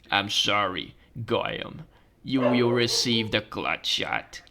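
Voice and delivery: low, slow villain voice; sinister doctor. Acted. Doctor overruling a patient who refuses, sinister. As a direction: intone it like an ominous doctor.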